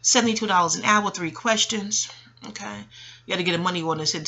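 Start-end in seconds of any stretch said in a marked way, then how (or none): none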